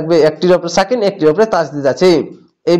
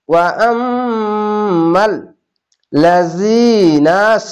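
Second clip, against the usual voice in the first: very slow chanted tajweed reading; drawn-out Quranic chant; slow recitation; much slower than usual. Shown as a demonstration. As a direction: stretch the long vowels in prayer style. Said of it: "এক আলিফ টেনে পড়বেন".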